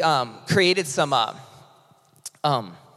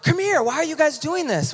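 much higher-pitched, incredulous question; puzzled teachers, imitated; 11 semitones higher than usual. Teachers asking volunteers about the free lunch, imitated amazement. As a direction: imitate someone amazed by unexpected kindness. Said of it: "They're like".

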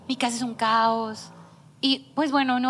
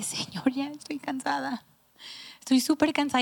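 mock tearful plea, pitched higher; llanto cansado imitado; 4 semitones higher than usual. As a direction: retell an exhausted, weepy complaint to God. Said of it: "me encerré en mi oficina llorando y dije". (queja llorosa y agotada, imitada)

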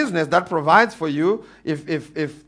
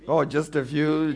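ordinary talk

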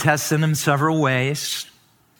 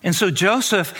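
ordinary talk, nothing marked

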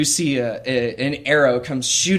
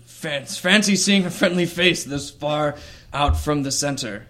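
hearty minotaur voice, raised then easing off; Gary; 2 semitones higher than usual. Acted. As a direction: greet a friend far from home as a friendly beast. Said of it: "Gary the Minotaur".

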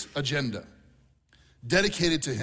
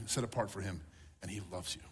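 tender hush; 8 semitones lower, 11 dB quieter than usual. Acted. Tender close to the sermon.

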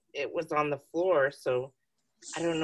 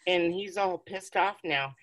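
usual speaking voice

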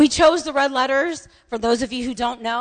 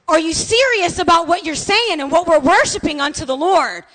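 louder, higher impassioned challenge; shouting like madman; 5 semitones higher, 5 dB louder than usual. Retold or imitated. Pastor challenging the worshippers that morning, impassioned shout. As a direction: belt out the question with urgency. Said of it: "he was shouting it like a madman".

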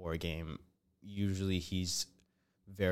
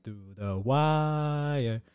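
higher, very slow drawn-out intro call; podcast intro voice; 6 semitones higher, 4 dB louder, much slower than usual. Retold or imitated. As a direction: stretch it like a show opener. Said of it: "it's like".

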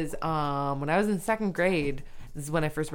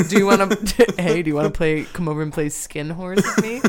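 laughing mock invite; 2 semitones lower, 6 dB louder than usual. Mocking friend's joke, laughing.

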